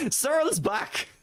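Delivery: slow, high, giggly delivery; real laughter bursting through the words; laughing. Real, not acted. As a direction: crack up as you say it. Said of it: "I shouldn't be laughing".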